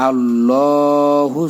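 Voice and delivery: আল্লাহু slow, heavy, one alif long; slow, full আল্লাহু; drawn heavy Allahu. Shown as a demonstration. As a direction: stretch আল্লাহু full-mouthed. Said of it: "এটা মোটা".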